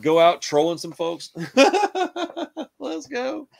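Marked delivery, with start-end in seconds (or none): 1.4-2.7 sudden high-pitched burst of laughter. Real, not acted. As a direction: cackle at your own dark joke. Cracks up at own joke.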